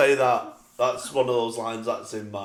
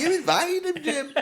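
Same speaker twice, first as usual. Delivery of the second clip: Barbara impression. Quoting Barbara to Jim.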